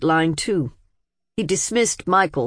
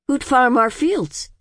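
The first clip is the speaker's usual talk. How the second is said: drawn-out, higher-pitched, feeling question; emotional question; 7 semitones higher than usual. A narrator's reading. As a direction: ask it with feeling, pitched up and slowed. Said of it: "feminine expression of emotion".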